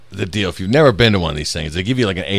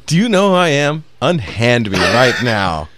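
hammy stage actor; 3 semitones higher, 4 dB louder than usual. Pretend. Pompous baron detained at airport.

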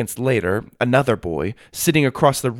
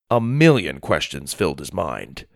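plain reading voice

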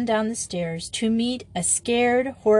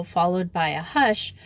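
ordinary delivery

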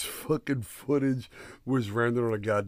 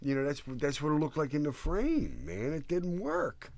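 ordinary talk

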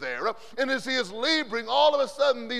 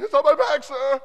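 loud, higher panicked answer; panicked recruit; 4 semitones higher, 11 dB louder than usual. Retold or imitated. Recruit with a bee on his back, loud panicked answer. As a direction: blurt it out in alarm.

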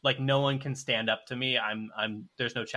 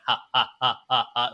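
evil chuckle, loud ha ha ha; anime villain laugh; 5 dB louder than usual. Retold or imitated. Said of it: "like, evil, like, anime villain laugh".

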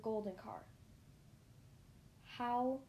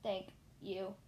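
metallic voice; 3 semitones lower than usual. A narrator's reading. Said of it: "a metallic voice behind me".

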